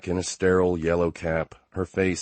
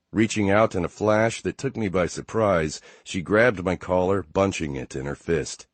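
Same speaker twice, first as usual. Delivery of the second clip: ordinary delivery, nothing marked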